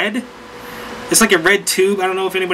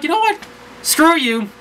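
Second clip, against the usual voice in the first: jumps much higher, snapping back at his friend; defiant retort; 9 semitones higher than usual. Retold or imitated. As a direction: fire back like you've had enough. Retorting to Steve's teasing.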